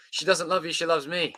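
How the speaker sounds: sing-song boast of a rival; mock jealous lover. Reading a cringy line to chat, lightly acted.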